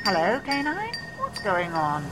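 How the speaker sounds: high female voice, far off; faint, high-pitched calling out; distant calling. A narrator's reading. Woman calls the dog, off scene.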